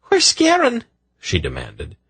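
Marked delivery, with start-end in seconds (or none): 0.0-0.8 very high, louder frantic demand; shrill demand. A narrator's reading. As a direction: shriek it, panicked mother. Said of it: "her voice shrill".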